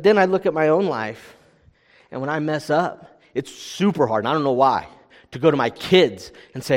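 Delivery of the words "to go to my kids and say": normal talking voice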